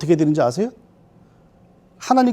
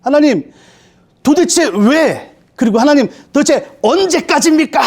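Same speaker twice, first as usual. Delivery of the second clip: higher, drawn-out anguished plea; crying out to God; 6 semitones higher, slower than usual. Acted. Believer crying out to God asking why, high pleading. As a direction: wail the question at heaven. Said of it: "막 이렇게 부르짖는 게 뭐예요".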